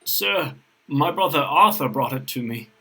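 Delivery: frightened admission. A narrator's reading. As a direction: own up to your father, a touch afraid. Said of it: "Frightened, Kay now admitted".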